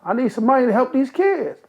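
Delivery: high, pleading insistence; a younger man pleading with a rich backer. Retold or imitated. Insisting to Jim.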